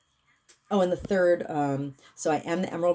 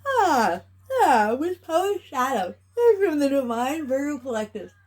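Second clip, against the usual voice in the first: ritual incantation; 8 semitones higher than usual. Acted. Healer proclaims the clearing to the collective, raised invocation voice. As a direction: command the energy like a spell.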